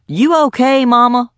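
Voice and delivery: high, loud call across the street. A narrator's reading. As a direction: call over to her from the doorway. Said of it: "he called".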